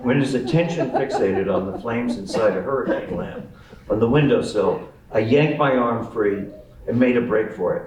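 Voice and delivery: ordinary delivery, nothing marked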